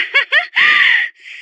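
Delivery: excited high-pitched laugh; high flirtatious giggle. Acted. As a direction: burst into a coquettish titter. Flirting, delighted.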